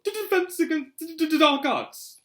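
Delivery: high, jittery stutter on the Ds; reluctant stammer. A narrator's reading. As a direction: stammer as though dreading the subject. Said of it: "as though he'd rather not think".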